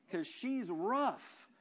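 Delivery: ordinary talk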